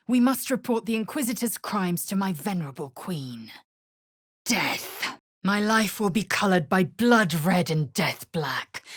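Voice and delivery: ordinary talk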